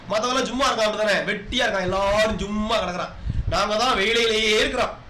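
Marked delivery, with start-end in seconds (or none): none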